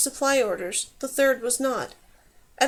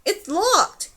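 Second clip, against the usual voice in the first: high-pitched helpless yell; raised, protesting shout; 7 semitones higher, 4 dB louder than usual. A narrator's reading. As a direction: call back through a door. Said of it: "yelled the man outside".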